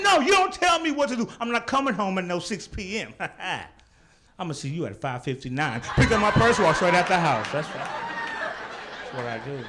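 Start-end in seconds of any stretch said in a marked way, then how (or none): 0.0-1.3 high, defiant shout at the wife; defiant outburst. Acted. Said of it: "So I tell my wife". 1.4-5.8 defiance collapsing into a soft mumble; backing down softly. Acted. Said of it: "So I tell my wife".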